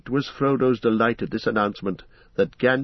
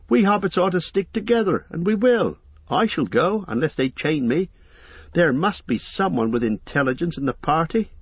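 put-on young hobbit Pippin; 6 semitones higher than usual. Pippin declares he will go.

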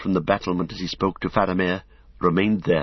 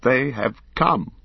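with an effort; 4 semitones higher, slower than usual. A narrator's reading. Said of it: "Beregond spoke with an effort".

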